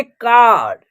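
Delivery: "कार" with the 'aa' stretched out; teacher's model "कार", A as long 'aa'; long 'aa' sound. Shown as a demonstration. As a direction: hold the open vowel in "कार". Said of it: "ए का उच्चारण आ हो रहा".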